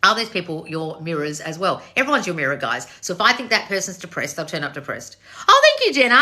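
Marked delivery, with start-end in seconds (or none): 5.5-6.2 high, loud burst of thanks; light up and squeal a thank you; excited thanks. Real, not acted. Host thanking Jenna mid-answer, bursting high and loud.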